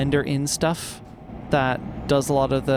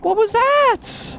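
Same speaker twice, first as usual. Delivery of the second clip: startled; 6 dB louder, much slower than usual. Real, not acted. Reacting to a sudden scare.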